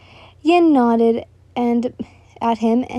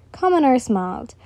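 plain reading voice

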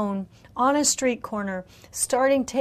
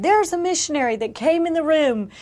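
louder, higher and fast, fervent announcing; excited ministers; 4 semitones higher, 8 dB louder than usual. Retold or imitated. Ministers announcing healing to the room, excited and loud. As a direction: proclaim the healing with rising fervour. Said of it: "and they said".

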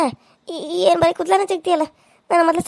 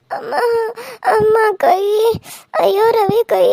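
fake crying; 5 semitones higher than usual. Wife fakes pain to husband Ravi, high fake sobbing. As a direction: keep whimpering to win sympathy.

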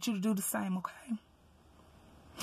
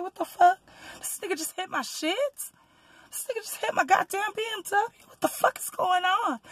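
retold disbelief; 6 semitones higher, 4 dB quieter than usual. Reenacting shock at car hit.

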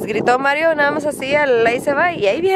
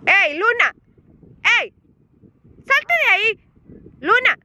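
shouting at dog; 8 semitones higher than usual. Real, not acted. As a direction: yell at her to get out. Le grita a la perrita. (grito agudo a la perrita)

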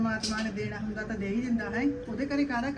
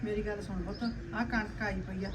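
soft reassurance; 2 semitones lower, 8 dB quieter than usual. Acted. Mother comforts worried daughter.

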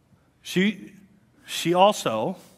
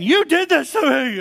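much higher and louder, furious blame; storming accusation; 12 semitones higher, 7 dB louder than usual. Retold or imitated. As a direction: storm in and point the finger. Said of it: "Sarah comes storming in saying".

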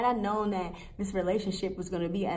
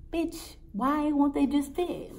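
exasperated; 7 semitones higher, slower than usual. Retold or imitated. Exasperated high question.

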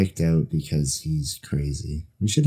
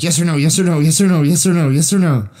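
high, loud, relentless mock pestering; nonstop rapid-fire demand; 9 semitones higher, 5 dB louder, faster than usual. Friend imitates erratic man on the phone, loud nonstop demanding. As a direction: repeat it without missing a beat. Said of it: "without missing a beat".

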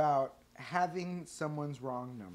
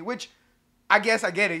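ordinary talk, nothing marked

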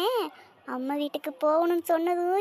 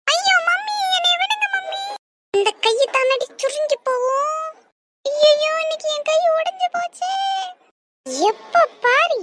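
high, loud wail of pain; 8 semitones higher, 5 dB louder than usual. Acted. Child begging mother to let go of her hand, pained wailing. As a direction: cry out as if your hand is being twisted.

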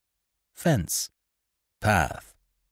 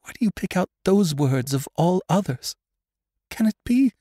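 high, desperate questioning; distraught cry near collapse; anguished plea; 11 semitones higher than usual. A narrator's reading. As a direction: plead in anguish, climbing higher. Anguished woman pleads before fainting.

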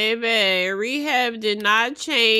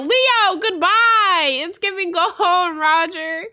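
high, loud teasing send-off; laughing dismissive goodbye; mocking goodbye; 7 semitones higher, 6 dB louder than usual. Real, not acted. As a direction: shoo him off, way up and loud. Telling Leo to leave.

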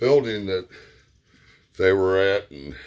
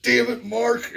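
high, louder stammering cuss; angry stuttering uncle; 3 dB louder than usual. Retold or imitated. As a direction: stammer then blurt it out, mock angry old man. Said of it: "He goes".

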